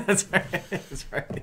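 laughing, high and loud. Real, not acted. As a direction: laugh it out in agreement. Laughing agreement.

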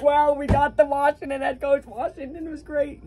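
high-pitched mimicry of gushing praise; mock-impressed squeal; mocking fanboy. Mocking a rival fan's sudden praise.

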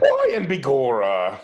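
hammed-up, badly faked Irish accent. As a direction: attempt an Irish accent and miss. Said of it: "That's a bad Irish accent".

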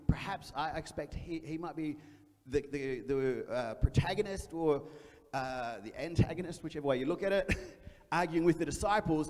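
normal talking voice

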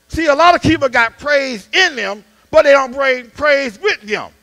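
rising sermon cadence, pressing the point; preaching. Acted.

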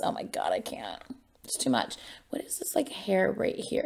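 whispered, overwhelmed gushing. Real, not acted. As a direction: breathe it out like you can't handle it. Gushing over [name]'s cuteness.

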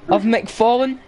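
usual speaking voice

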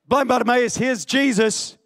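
usual speaking voice

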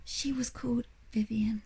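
breathy hushed whisper. A narrator's reading. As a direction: say her name in awe. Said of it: "She said it in a whisper".

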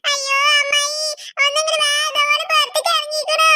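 acted wail with sobs after it; crying. Child calling her aunt to come, crying. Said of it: "പിന്നെ എന്തിനാ വെറുതെ ഇരുന്ന് കരയുന്നത്?".

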